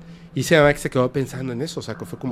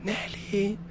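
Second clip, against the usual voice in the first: high, drawn-out mock yell, kept soft; softly mimicked shout from outside; imitated call-out; 8 semitones higher, 7 dB quieter than usual. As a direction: call out a name high but without real volume. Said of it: "le grita".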